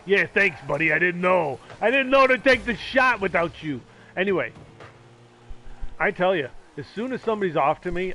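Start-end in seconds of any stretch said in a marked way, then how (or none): 0.0-3.8 loud, mounting sarcasm; sarcastic retort. Real, not acted. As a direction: throw the useless advice back, raising your voice. Snapping back at obvious game advice.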